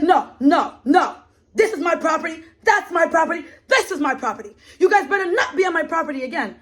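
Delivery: high mock angry man warning. Retelling the landowner warning them off, high mock anger. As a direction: imitate the landowner laying down the law.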